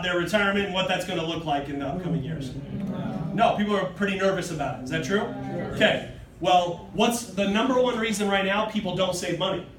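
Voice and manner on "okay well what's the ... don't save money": normal talking voice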